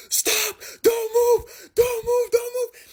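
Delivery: very high, frantic shouts; shrill panicked commands; panicked yelling. Retold or imitated. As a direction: scream at the driver to stop. Trapped under car, yelling.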